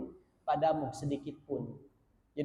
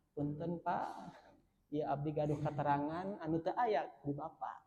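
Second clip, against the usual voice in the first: soft, humble Sundanese politeness; polite, gentle request; 7 dB quieter than usual. Acted. Son respectfully telling his father what he knows, polite gentle request. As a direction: say it softly, deferring respectfully to an elder. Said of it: "bagaimana bahasa yang paling halus".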